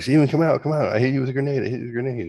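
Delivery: high, loud playful calling. Retold or imitated. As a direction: call him out of hiding, teasing. Kids playing, calling out to brother.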